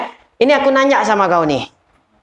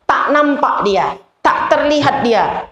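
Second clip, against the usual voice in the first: slow emphasis; 3 semitones higher, slower than usual. Shown as a demonstration. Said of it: "slowed emphatic teaching".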